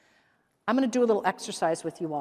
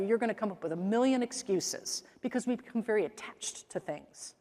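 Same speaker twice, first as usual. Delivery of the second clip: usual speaking voice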